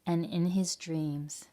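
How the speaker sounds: gentle storybook reading voice; story narration. A narrator's reading. Teacher reading a picture book to children, gentle narration. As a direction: read it like a bedtime tale to kids.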